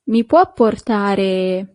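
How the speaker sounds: "Mi può portare?" said slowly, every syllable clear, stress on "può"; slow model phrase. Shown as a demonstration. Teacher modelling "Mi può portare?" slowly for the class. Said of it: "Yes, that's".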